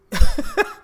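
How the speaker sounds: sudden loud burst of laughter; loud high laugh. Real, not acted. Laughing at his lead pipe remark, loud and high. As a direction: crack up loudly at your own line.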